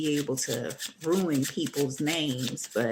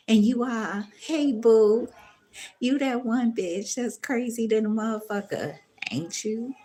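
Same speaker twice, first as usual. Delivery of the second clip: loud mock greeting; 6 semitones higher, 5 dB louder than usual. Voicing a loud mocking greeting.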